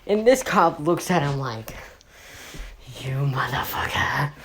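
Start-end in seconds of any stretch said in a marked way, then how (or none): 3.0-4.3 unvoiced, acted seething whisper. Cop who hauled the cooler, seething whisper. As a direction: whisper it like a worn-out, fed-up man. Exhausted cop glares at student.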